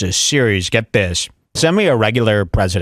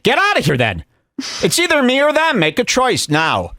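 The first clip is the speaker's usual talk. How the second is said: higher, mock-indignant ultimatum; hammed-up fury at a fan; mock outrage; 12 semitones higher than usual. Host mock-furious at a chat fan, pitched way up. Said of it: "mock outrage at a fan".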